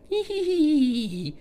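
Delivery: short high-pitched giggle; a quick high laugh. Real, not acted. Laughs after Thailand remark.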